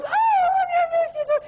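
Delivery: very high, slow squealed reply; mock gushing wife. Wife answers her husband's sweet talk, high hammed-up gushing. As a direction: gush back in a squeaky imitation. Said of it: "She goes".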